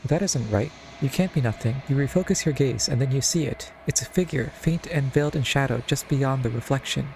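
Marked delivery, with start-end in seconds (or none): none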